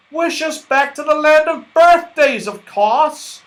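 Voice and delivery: high, loud and eager; excited yell. Acted. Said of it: "yelled Moonface in excitement".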